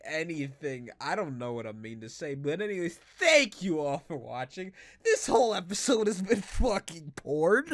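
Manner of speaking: ordinary delivery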